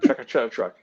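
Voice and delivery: "Tractor trailer truck" run together fast; slurred into one word. Shown as a demonstration. Answering his sister's request, "Tractor trailer truck" as one word. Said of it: "it's all one word".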